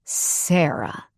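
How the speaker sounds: ordinary delivery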